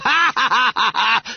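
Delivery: high acted laughter; class guffawing. A narrator's reading. The form laughs at Bunter's excuse, guffawing.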